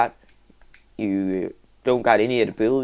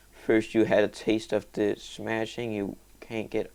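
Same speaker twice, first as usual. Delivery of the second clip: ordinary talk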